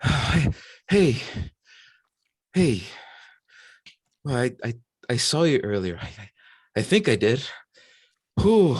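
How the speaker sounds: high, loud frazzled capybara voice; jittery energy of someone still coming back to life; very energetic. Acted. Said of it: "very energetic".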